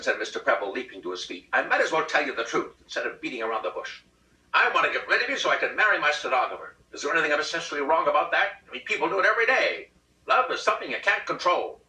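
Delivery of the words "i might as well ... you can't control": rápido, agudo e alto; confissão agitada. A narrator's reading. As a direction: marido despejando a verdade. Husband blurting confession.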